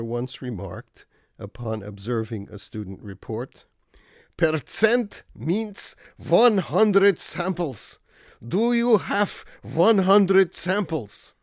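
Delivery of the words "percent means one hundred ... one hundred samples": put-on old world German professor, high, loud and slow; stern German-accented lecture, pitched up and loud. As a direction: scold a student in a thick German accent, each word drawn out. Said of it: "one is also in a German accent".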